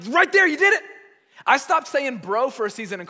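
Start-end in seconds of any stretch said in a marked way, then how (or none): 0.1-0.8 high, loud mock mom catching him out; imitating mom. Mom catching her son's new accent, loud high mock voice. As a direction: pitch it way up and call it out. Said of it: "And she was like".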